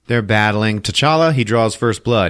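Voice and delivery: usual speaking voice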